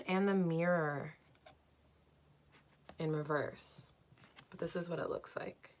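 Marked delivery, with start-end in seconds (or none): 4.5-5.7 soft unvoiced whisper. Real, not acted. Showing viewers the card in a whisper. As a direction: whisper it as an aside.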